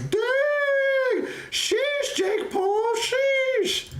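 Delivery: excited shriek. Retold or imitated. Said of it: "And I was like".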